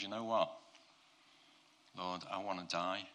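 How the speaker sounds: a touch of defeat, giving up; despondent. Acted. Preacher as gutted Elijah praying to God, mildly despondent. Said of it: "absolutely gutted, absolutely despondent".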